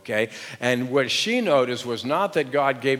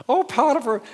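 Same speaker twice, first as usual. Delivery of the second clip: "Oh, Potiphar" in a much higher, louder falsetto; too high; 11 semitones higher, 5 dB louder, faster than usual. Shown as a demonstration. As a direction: overshoot up into a squeaky wife voice. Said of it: "no, I'm not supposed to go high".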